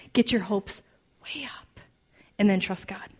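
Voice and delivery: whispered, drawn-back exhortation; hushed encouragement. Real, not acted. Preacher urging listeners to hope, near-silent whisper. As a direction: drop right down to a whisper.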